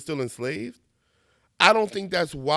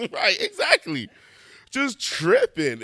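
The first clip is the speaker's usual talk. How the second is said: mock agreement; 3 dB louder, slower than usual. Mocking the offended people.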